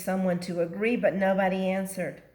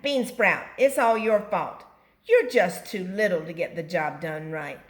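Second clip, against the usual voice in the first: scolding; 7 dB louder than usual. Acted. Blaming little Bean Sprout.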